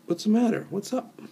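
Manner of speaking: high-pitched, checking what's wrong; concerned questioning. Shown as a demonstration. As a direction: ask it pitched well up, as if something's the matter. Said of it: "it changes the, the, the tone".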